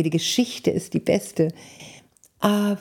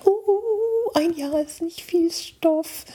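high, slow mock worry over thin material; mock dismay; 12 semitones higher, much slower than usual. Wedding speaker voicing her own worry about too little material, high and slow. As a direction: stretch it out like a sigh of mock despair.

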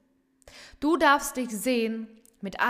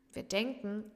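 usual speaking voice

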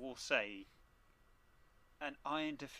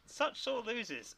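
mocking sing-song; 10 semitones higher, slower than usual. Host mocking losers.

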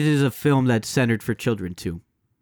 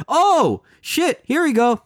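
surprised; 11 semitones higher, much slower than usual. Real, not acted. Reacting to another Oscar snub.

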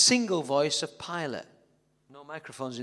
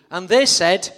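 loud cue; 11 dB louder than usual. Acted. Loud narration cue to crowd.

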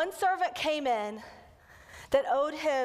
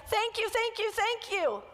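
high, gushing thank-yous, mildly hammed-up; grateful thanks; 11 semitones higher than usual. Forgiven servant thanking the king, hammed-up high gratitude. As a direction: overflow with mock gratitude, pitched up. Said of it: "I want the servant to be like".

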